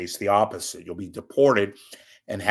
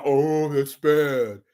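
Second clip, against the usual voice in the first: slow, drawn-out groan of a listener; mock the audience reaction slowly; imitating viewers groaning at the bad joke; 5 semitones higher, 5 dB louder, much slower than usual. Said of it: "Don't give me the fu".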